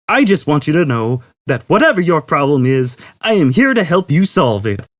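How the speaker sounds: brash cheery computer. A narrator's reading. Computer offering help to Zaphod, brash and cheery. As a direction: sell it like a detergent ad. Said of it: "brash and cheery".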